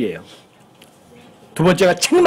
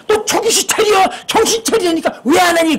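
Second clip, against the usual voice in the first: high-pitched scolding mum voice; exasperated motherly nagging, acted out; 11 semitones higher than usual. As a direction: nag your child in a raised, high voice. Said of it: "잔소리하는 거예요".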